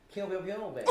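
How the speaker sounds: laughing gun noises. Pretend. Gun sound imitation then laugh.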